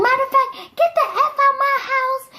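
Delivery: high-pitched mock tirade; imitated furious mom. Mom throwing dad out, mock furious. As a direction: order them out like an enraged mother. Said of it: "She was like".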